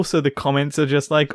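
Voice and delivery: usual speaking voice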